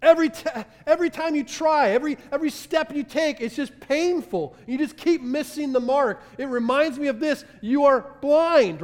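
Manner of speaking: high, loud impassioned preaching; fervent pulpit delivery; preaching at full force. Acted. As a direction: drive it home, building intensity.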